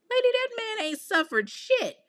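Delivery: high, loud outrage at the mother; outraged outburst. Real, not acted. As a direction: snap back at her, indignant. Outraged reply to mother's letter.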